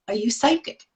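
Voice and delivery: quiet, awed hush; as an amazed student; stunned students. Retold or imitated. Students reacting to their art teacher's insight, awed and quiet.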